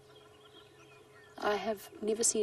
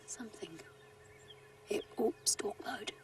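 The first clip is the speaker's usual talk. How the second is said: wildlife watching whisper; 2 semitones higher, 7 dB quieter than usual. Real, not acted. Spotting a cat starting to stalk.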